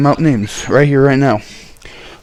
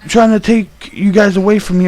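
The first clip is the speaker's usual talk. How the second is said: high, urgent accusation as imitated; imitated worked-up accusing voice; agitated mother, retold; 9 semitones higher than usual. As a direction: act out mom's alarmed complaint, pitched up.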